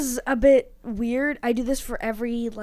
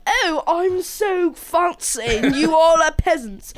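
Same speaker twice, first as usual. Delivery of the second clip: slow, higher, hammed-up posh British snob; 6 semitones higher, 5 dB louder, slower than usual. Suggesting a snooty British card voice, hammed-up. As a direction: look down on the peasants, slowly. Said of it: "sip your tea on stage".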